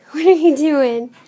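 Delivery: ordinary talk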